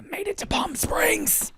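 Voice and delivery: excited whispered cheer; triumphant whisper. Real, not acted. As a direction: whisper it like a victory shout. Triumphant arrival, unvoiced on the audio.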